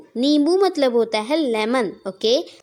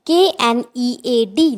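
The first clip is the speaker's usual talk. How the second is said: slow spelling; 5 dB louder, slower than usual. Shown as a demonstration. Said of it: "teacher spells the word".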